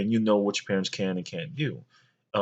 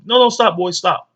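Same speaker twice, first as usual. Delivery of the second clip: higher, louder mom shouting stop; mom scolding; 8 semitones higher, 7 dB louder than usual. Retold or imitated. Mom stopping her son opening the present, higher, louder scolding. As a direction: snap at the kid to freeze, pitched up and loud. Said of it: "my mom".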